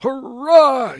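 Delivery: high, loud crowd hurrah; roaring mob approval; yelled cheer. A narrator's reading. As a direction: yell out a wild cheer. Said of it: "yelled the crowd".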